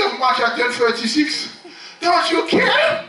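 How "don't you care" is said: high, drawn-out question; mock plea. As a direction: stretch it high in character. Mimicked complaint.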